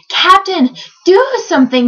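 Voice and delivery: high, urgent shout. A narrator's reading. Bartholomew begging the captain to act, shouted. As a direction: call out for help. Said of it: "shouted Bartholomew".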